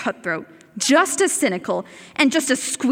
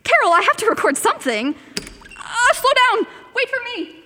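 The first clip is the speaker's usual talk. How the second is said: flustered; 10 semitones higher, faster than usual. Acted. Chasing Carol, flustered.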